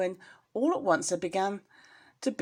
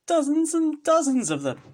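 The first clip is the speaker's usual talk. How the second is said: excited exclaim; 9 semitones higher, 6 dB louder than usual. Real, not acted. Reader exclaims about rat tails.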